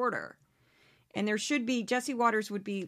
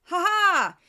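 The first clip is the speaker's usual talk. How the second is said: mocking laugh; 8 semitones higher, 9 dB louder than usual. Real, not acted. Mocking Jesse Waters' logic.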